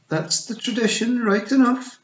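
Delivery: high excited Scottish pixie; little Scottish warrior voice; Scottish accent. A narrator's reading.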